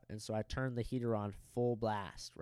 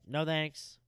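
raised, higher-pitched call; loud refusal; 5 semitones higher, 4 dB louder than usual. Retold or imitated. Guest calling to housekeeper through the door, loud. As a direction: call it out loud enough to carry. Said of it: "loud enough to where she could hear me".

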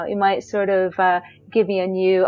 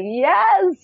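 sudden high, louder cheer; excited yes; 10 semitones higher, 3 dB louder than usual. Retold or imitated. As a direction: burst out with joy at getting in. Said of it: "And I was like".

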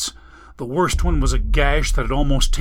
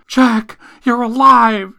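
high, loud startled cry; woman shrieking at her lover's return; shocked shriek; 9 semitones higher, 9 dB louder, slower than usual. Acted. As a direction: shriek as if seeing a ghost. Amelia shrieks seeing him.

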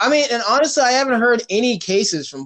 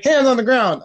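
cop voice; 7 semitones higher, 5 dB louder than usual. Retold or imitated. Mimicking police order.

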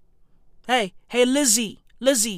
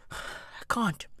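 whispered despair; 4 semitones lower, 14 dB quieter than usual. Acted.

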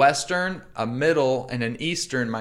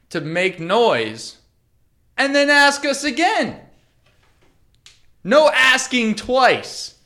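higher, louder, slowed, each word punched; emphatic rant; 9 semitones higher, 4 dB louder, slower than usual. Real, not acted. Telling friends his comedy rule, he rants emphatically. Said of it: "ranting about crowd work".

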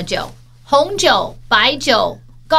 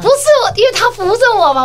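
much higher, louder protest; defensive protest; 11 semitones higher, 6 dB louder than usual. Real, not acted. Denying the harassment jab from her friend, loud protest. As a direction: push back fast, indignant.

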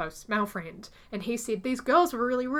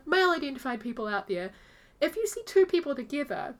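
normal talking voice

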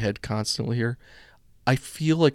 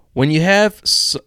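a hint of Fauci, higher and louder; Doctor Fauci impression; 6 semitones higher, 7 dB louder than usual. Host attempting a Fauci voice for listeners, lightly done. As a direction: try on a mock Fauci voice, half-hearted. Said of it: "I can't even do my Doctor Fauci".

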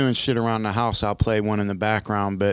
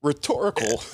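ordinary delivery

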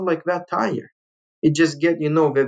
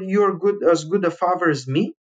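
ordinary delivery

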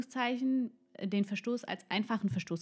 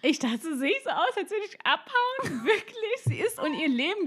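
much higher, climbing squeak of disbelief; incredulous; 10 semitones higher than usual. Real, not acted. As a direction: sound outraged that anyone thinks you'd flee. Disbelief at being suspected.

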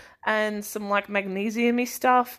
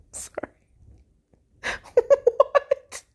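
usual speaking voice

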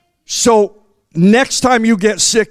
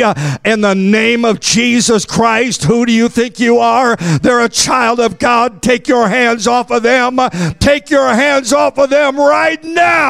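rising shouted preaching; fiery preaching; 4 semitones higher than usual. Acted. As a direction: rebuke it for the congregation. Preaching at full force.

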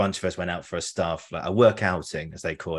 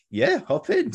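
chirpy policewoman; slower than usual. Retold or imitated. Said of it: "she went".

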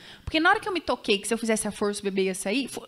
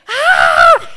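loud high pained yell; reenacting her own scream in labour; imitated shout; 11 semitones higher, 15 dB louder than usual. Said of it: "Eu fazia".

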